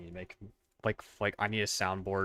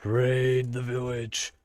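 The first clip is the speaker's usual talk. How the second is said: viking; 3 semitones higher, 4 dB louder, much slower than usual. Pretend. Leading a Minecraft village raid.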